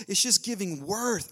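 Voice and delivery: usual speaking voice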